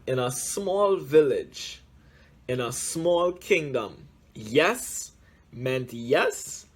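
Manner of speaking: plain reading voice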